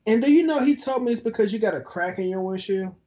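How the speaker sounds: normal talking voice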